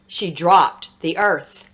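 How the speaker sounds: ordinary delivery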